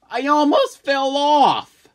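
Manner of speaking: high, loud panic; frightened. Acted. Jack panicking after nearly falling. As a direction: blurt your terror.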